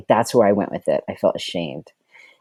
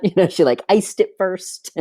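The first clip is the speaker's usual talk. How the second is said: giggling through the words, voice pitched up; laughing; 7 semitones higher than usual. Real, not acted. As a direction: laugh while you tell it. Laughing while telling the ear piercing.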